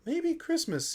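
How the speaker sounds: a hint of the Grinch's surprise; dawning Grinch thought. A narrator's reading. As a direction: let the idea dawn on him. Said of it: "he thought".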